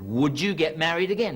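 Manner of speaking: a touch of insistence; insistent wife. Acted. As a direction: press him for an answer. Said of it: "And she said".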